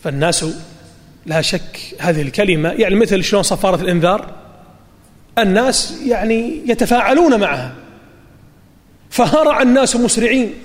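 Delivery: ordinary talk, nothing marked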